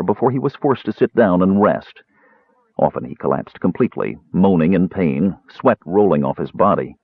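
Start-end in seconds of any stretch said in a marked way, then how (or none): none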